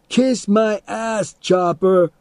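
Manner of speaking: high, slow mocking jeer; taunting. A narrator's reading. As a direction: tease the dog with glee. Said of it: "Teddy invited".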